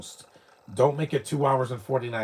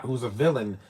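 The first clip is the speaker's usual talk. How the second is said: ordinary talk, nothing marked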